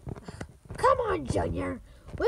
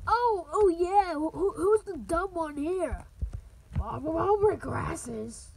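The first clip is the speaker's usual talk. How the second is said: ordinary talk, nothing marked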